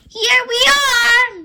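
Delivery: high, slow little squeak; squeaky voice. A narrator's reading. Miss Rabbit arriving at the palace, squeaking high and slow. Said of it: "Miss Rabbit squeaks".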